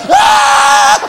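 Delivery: startled by a dog that barks without sound; mock panic. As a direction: yell out in fake alarm at the silent dog. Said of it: "You're like".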